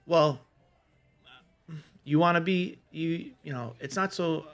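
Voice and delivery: ordinary delivery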